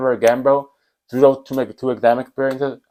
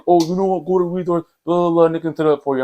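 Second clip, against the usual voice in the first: higher, quick dismissive teacher voice, lightly done; mocking teacher voice; 7 semitones higher than usual. Teacher sending student to resource, lightly mocked dismissive voice. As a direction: brush him off fast with a mocking lilt. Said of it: "He's like".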